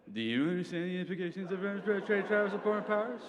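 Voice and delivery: high, climbing, slurred parroting of a question; dumb mocking voice. President repeating the secretary's question back, dumb voice. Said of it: "in a dumb voice".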